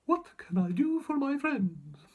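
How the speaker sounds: high, slow squeaky little mouse voice; squeaky mouse queen. A narrator's reading. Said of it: "in her squeaky little voice".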